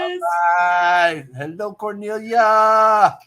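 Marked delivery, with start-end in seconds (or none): 1.3-3.2 high, loud drawn-out hello; called-out greeting. Real, not acted. Host hailing a guest on arrival, called-out greeting. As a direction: call it out in a long sing-out.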